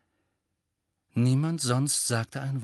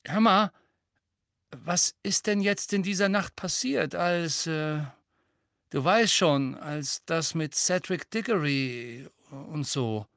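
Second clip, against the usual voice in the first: hesitant, higher-pitched nosy question; nervous and curious; 5 semitones higher than usual. A narrator's reading. Seamus asking Harry about that night, nervous and curious. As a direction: probe nervously, faltering around the awkward part. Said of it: "Seamus klang nervös und wissbegierig zugleich".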